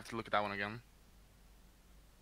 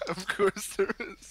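laughing; 7 semitones higher, slower than usual. Real, not acted. Echoing partner's exasperation.

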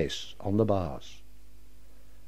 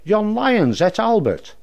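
hollered; 8 semitones higher than usual. Acted. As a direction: holler it out in alarm to the keepers. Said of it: "she hollered".

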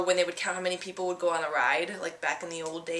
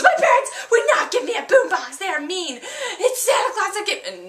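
put-on high, loud kid voice, huffy and proud; indignant little girl; 12 semitones higher, 7 dB louder than usual. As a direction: insist like a stubborn child defending Santa. Girl protests.

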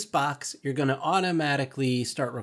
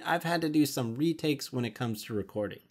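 ordinary talk

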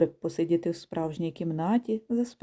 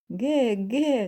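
geese calling; 4 semitones higher than usual. Acted. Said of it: "кричать крилаті мандрівники".

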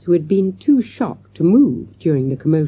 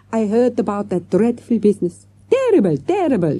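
tag; 5 semitones higher than usual. A narrator's reading. Flustered French manager answers the girl.

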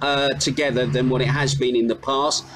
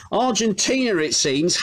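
ordinary delivery

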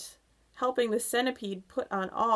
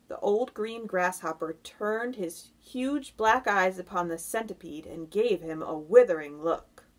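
ordinary delivery

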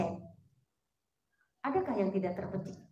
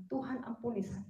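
quiet plea for mercy; 8 dB quieter than usual. Retold or imitated. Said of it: "minta ampun sama Tuhan".

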